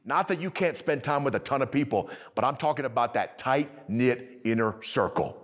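ordinary talk

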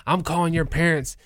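a hint of a cranky old man shouting at kids; mock yelling old man. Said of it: "he starts yelling".

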